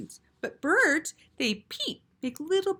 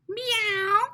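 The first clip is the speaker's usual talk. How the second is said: cat meow; 12 semitones higher than usual. Acted. A cat voice interrupts.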